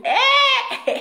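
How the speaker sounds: high squeal breaking into a laugh; delighted high cry. Real, not acted. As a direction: shriek with laughter.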